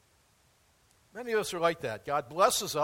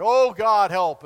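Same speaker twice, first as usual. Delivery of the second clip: louder desperate plea; crying out to God; 12 dB louder, slower than usual. Retold or imitated. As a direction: call up to God for rescue. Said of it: "They're crying out to God".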